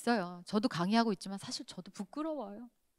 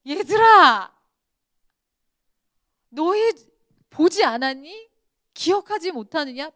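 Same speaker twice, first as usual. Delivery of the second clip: 크게 높여 꾸짖듯 부르는 예수님; 제자들을 향해 목소리를 높여 다그치듯; 예수님의 외침; 8 semitones higher, 13 dB louder than usual. Retold or imitated. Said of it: "예수님이". (제자들을 꾸짖는 예수님, 크고 높은 목소리로)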